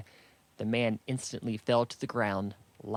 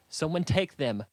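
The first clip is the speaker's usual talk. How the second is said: louder, higher narrated shout; shouted order; 5 semitones higher, 5 dB louder than usual. A narrator's reading. Rusu sends armed men after the flowers, shouting the order. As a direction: call out a command to the group. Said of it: "Rusu shouted".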